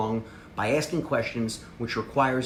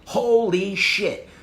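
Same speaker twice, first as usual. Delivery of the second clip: slow, louder, much higher holy shit; hyped buddy; 7 semitones higher, 4 dB louder, much slower than usual. Shown as a demonstration. Talking to beer-drinking buddies, hyped. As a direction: drag it out like big news. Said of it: "you gotta be like".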